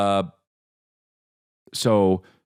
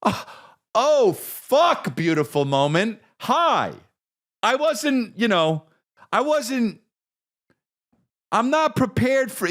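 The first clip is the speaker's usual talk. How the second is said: much higher, cracking with tears; retold weepy squeak, slow at the end; choked-up crying voice; 14 semitones higher, slower than usual. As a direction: caught off guard and welling up. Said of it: "start tearing up".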